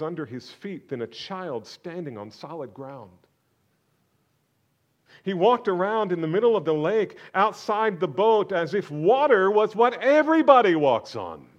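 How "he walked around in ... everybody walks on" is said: loud, high, driving preacher's questions; preaching at full force. Acted. Preacher demanding answers from the congregation, at full force. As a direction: hammer each question home from the pulpit.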